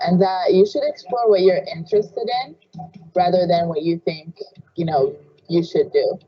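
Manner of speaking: ordinary talk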